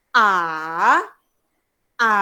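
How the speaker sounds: A dipping down, then climbing; long, droning fall-then-rise A; third tone. Shown as a demonstration. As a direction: hum A down and back up. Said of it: "Kita turun, kemudian naik".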